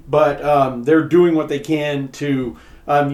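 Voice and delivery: ordinary talk, nothing marked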